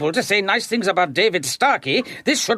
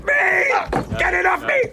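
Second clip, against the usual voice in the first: panicked dummy shout; 10 semitones higher than usual. Acted. Dummy struggling, calling for help.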